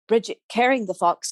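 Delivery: usual speaking voice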